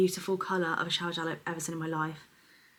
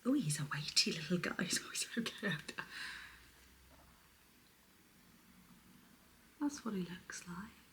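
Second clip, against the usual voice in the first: hushed unboxing whisper; breathy product whisper; soft whisper; 13 dB quieter than usual. Real, not acted. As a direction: whisper it close to the mic like ASMR.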